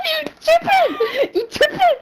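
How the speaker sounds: very high, slow squeal through laughter; laughing retold disbelief. Retelling reply to Axel's remark, laughing. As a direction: squeal it twice while cracking up.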